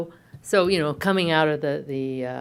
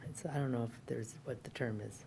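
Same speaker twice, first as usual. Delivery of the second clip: whispered aside; 4 semitones lower, 15 dB quieter than usual. Real, not acted. Searching for a word, aside.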